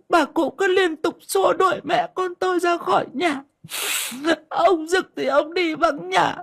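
very high, tearful wailing complaint; shrieky fake weeping, very high-pitched; hammed-up sobbing. A narrator's reading. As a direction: play the wronged daughter-in-law, crying theatrically for an audience. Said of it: "Mụ sụt sùi".

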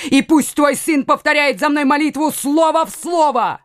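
high, insistent order; commanding. A narrator's reading. Coconnas demands the son repeat the prayer, commanding. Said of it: "приказал Коконас".

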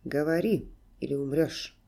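low-pitched threatening order; sharp command. A narrator's reading. Serge threatening the captive dwarf, sharp command. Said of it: "велел он резко".

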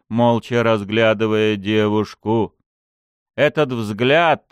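plain reading voice

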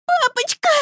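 put-on little girl calling daddy; frightened child. (высоким детским голосом)

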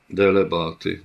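plain reading voice

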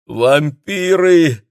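high, drawn-out wail of panic; loud moan. A narrator's reading. Hotel guest raises the alarm about vampires, moaning loudly. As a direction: moan it out loudly, stretching the word. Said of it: "громко простонал тот".

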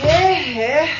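hey, everyone, listen up; calling out. Acted. Calling colleagues to attention.

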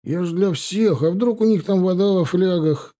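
high-pitched, halting self-defence; mumbled excuse. A narrator's reading. Scolded soldier making excuses to his comrade, high-pitched. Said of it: "сопя пробормотал тот".

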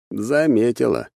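ordinary delivery

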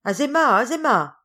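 loud, high call to a maid. A narrator's reading. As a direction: call impatiently up to someone upstairs. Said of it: "appela sa femme de chambre".

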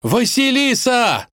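high, drawn-out shout; urgent far-off call. A narrator's reading. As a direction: holler the name, way up high. Prince's voice calls, interrupting.

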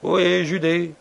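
high, loud call across water; doleful hail. A narrator's reading. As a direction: hail the ship plaintively. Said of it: "Une voix dolente s'éleva".

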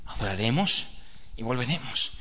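weary father. Acted. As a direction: answer "adoraremos y volveremos" low and heavy. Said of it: "Sí".

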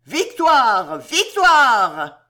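high, very loud triumphant cry; delirious victory yell. A narrator's reading. Frenzied women flooding the courtyard, howling in triumph. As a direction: shout victory at full voice. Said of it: "femmes délirantes qui hurlaient".

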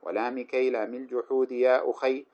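slow chanted poem, rising in level; verse recitation. Acted. Teacher chanting the poem on the particles to students.

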